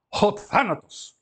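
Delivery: Ho thanatos with a lisped th, slow; model pronunciation. Shown as a demonstration. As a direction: Ho thanatos, lingering on the article. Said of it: "Th, né?".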